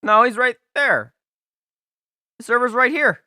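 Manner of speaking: startled high yelp; scared yelp. Real, not acted. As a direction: cry out, suddenly startled. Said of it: "Oh, you scared me".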